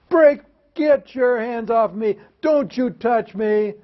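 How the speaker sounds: high, slow, drawn-out mock scream; imitated screaming kid. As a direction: play the tantrum kid crying out against being grabbed. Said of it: "he's just screaming and carrying on".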